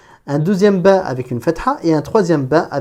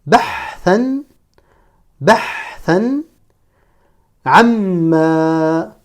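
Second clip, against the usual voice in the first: each word isolated and drawn out; deliberate Arabic reading model; detached word-by-word; much slower than usual. Shown as a demonstration. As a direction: hold the sounds, pause between words. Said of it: "chaque mot de manière détachée".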